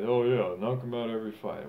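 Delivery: mock the armchair critic's easy words; mocking a clown. Boxer mimics critics demanding knockouts, sneering mock voice. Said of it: "it's easy for some clown to say".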